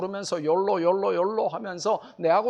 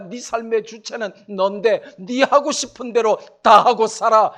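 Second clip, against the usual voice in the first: defiant, goading questions, louder and higher; mock rebellious youth; 5 semitones higher, 5 dB louder than usual. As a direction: taunt like a rebel who won't listen to anyone. Preacher voices rebellious youth creed. (반항하는 젊은이 흉내, 도발적으로)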